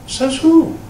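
a sharp, high "says who"; challenging retort. Acted. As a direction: toss it back as a challenge. Rhetorical challenge to legalism.

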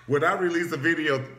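loud, high laugh through words; real laughter mid-sentence. Real, not acted. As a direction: crack up at your own joke. Laughs at own joke.

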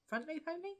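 "front loop only?" asked with a high questioning rise; unsure question. Acted. To viewers, unsure of the stitch name, she asks with a high rise.

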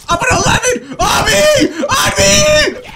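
loud high battle calls; real excited shout. Real, not acted. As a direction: yell for the team to group up. Calling team in firefight.

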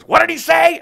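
Gary Busey impression. Busey pitching a football date to Toby, high and loud. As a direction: pitch it like a man with wild plans. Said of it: "and he goes".

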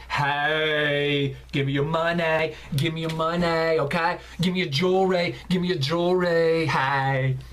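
rob them in a deep, sultry voice; low-pitched sexy bandit; sexy robber. Acted. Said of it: "makes me look like a sexy robber".

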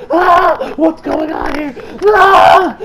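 high, loud yelps of fright; mock startled scream. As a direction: act out a panicked jump-scare. Acts out startled character.

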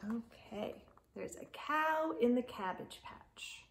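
ordinary delivery

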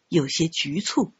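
ordinary delivery, nothing marked

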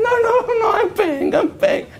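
very high, laughing mock protest; friend insisting. Friend caught out insists he will pay, high and laughing. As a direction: squeak out that you'll pay, laughing.

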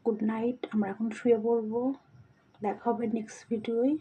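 low, hushed good night; quiet bedtime voice. Real, not acted. Bedtime sign-off, voice dropped.

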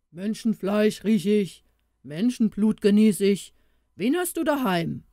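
man-eater demanding to know who is there; forceful, menacing ogre chant; ogre's cry. A narrator's reading. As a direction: roar it lightly as a storybook ogre. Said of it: "schrie er".

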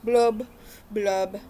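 lively picture-book read-aloud; reading to children. A narrator's reading. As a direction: read it like storytime for little ones.

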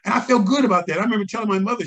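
usual speaking voice

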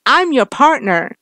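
loud, high, slow mockery; taunting. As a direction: sneer it out, daring him. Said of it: "Gary laughed".

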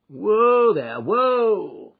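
loud, high call to the horses; calling whoa. Acted. Driver calling loudly to halt his horses. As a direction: rein in the horses with a shout.

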